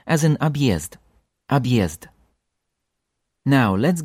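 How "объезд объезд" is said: объезд with a clear ye after the b; объезд: abyezd; Ye after hard sign. Shown as a demonstration. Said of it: "presence of hard sign".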